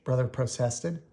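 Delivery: plain reading voice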